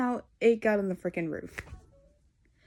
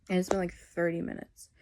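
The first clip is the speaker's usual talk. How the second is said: ordinary talk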